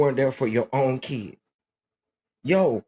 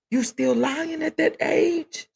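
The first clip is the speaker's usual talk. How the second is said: mock disbelief; slower than usual. Teasing mother about her age.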